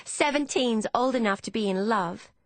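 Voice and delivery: put-on high, insistent young woman; defiant. Emmeline arguing with Hannah about love, defiant. Emmeline argues back at her sister.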